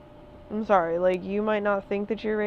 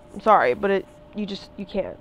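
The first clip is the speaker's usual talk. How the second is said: normal talking voice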